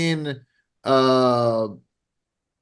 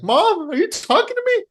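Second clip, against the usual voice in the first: very high, mock daughter voice; Annabeth. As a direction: squeak it like a kid questioning Mom. Acting Annabeth answering her mother Athena.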